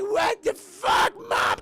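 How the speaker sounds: high, loud, slow mock wail; hammed-up drawn-out whiny shout; derpy shocked yell. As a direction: imitate a kid derping out mid-zap. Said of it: "every time he gets super derpy".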